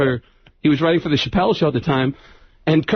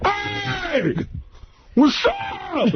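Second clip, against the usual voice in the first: very high, drunken mimicked holler; wasted Tracy Morgan; 14 semitones higher, 4 dB quieter, much slower than usual. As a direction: greet like a wasted comic, voice pitched way up. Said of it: "he turns around and he's wasted".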